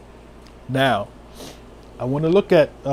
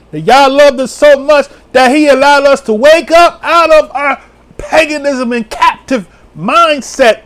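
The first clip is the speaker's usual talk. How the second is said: raised, loud testimony easing toward the end; fervent preaching; 8 semitones higher, 5 dB louder than usual. Real, not acted. Teary preacher on how Yah loved us, fervent preaching. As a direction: pour out praise, moved by it.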